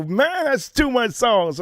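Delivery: high, slow emphatic too much sauce; drawn-out catchphrase. Retold or imitated. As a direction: savour the catchphrase. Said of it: "I go".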